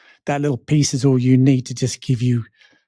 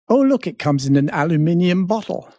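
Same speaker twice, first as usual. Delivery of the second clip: lightly British, higher, ALUMINIUM in five syllables; 5 semitones higher than usual. Retold or imitated. Reading a label aloud, in a light British accent. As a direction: say aluminium the British way, al-yoo-MIN-ee-um. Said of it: "next to it was the".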